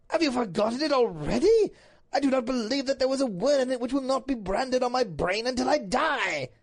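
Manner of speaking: loud, high, impassioned declamation; appalled Duke. Acted. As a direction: protest with melodramatic force. Duke appalled at forgotten letter.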